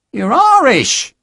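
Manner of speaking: high, slow and delighted, stretching Irish; thrilled to meet an Irishman at last. A narrator's reading. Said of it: "said it delightedly".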